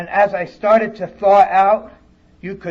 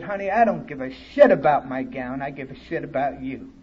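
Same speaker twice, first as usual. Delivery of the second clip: tender; 5 semitones lower, 4 dB quieter than usual. Retold or imitated. Woman reassuring ashamed newcomer.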